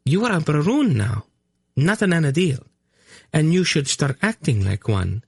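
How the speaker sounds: put-on high, prim young woman; prim correction. A narrator's reading. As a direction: primly set your uncle straight. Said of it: "Tolnedra corrected primly".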